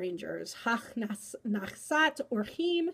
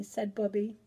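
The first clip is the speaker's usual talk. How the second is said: usual speaking voice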